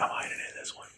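a hushed secretive whisper; mock whisper from inside a star. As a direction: whisper it like he's hiding. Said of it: "and he's just like".

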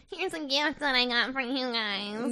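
mock goblin voice, drawn out; slowly, like a little goblin imitating someone. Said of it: "fucking little goblin".

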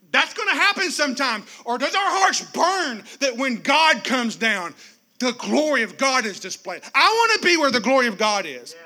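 normal talking voice

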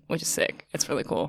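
whispered, trailing off; almost whisper it; quiet whisper. Real, not acted. Whispered aside.

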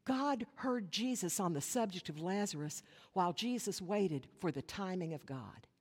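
ordinary talk, nothing marked

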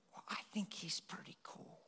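put-on soft whisper, a child confiding; whispered secret. Little boy whispers to his mom about the stranger.

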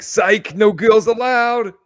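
high, loud gotcha jeer; gleeful taunt. Acted. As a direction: crow it like a playground taunt, drawn out. Mocking taunt Biden should have said.

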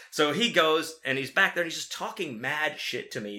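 usual speaking voice

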